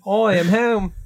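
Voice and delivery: a hammed-up Australian accent for the returning father. Father comes home to his plastic family, mock Australian accent. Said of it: "slight Australian accent now".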